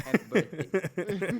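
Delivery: mock birthday greeting. Mock greeting to bathroom victim.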